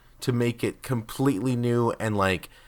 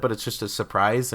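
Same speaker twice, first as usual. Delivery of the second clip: normal talking voice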